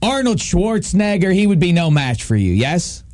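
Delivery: normal talking voice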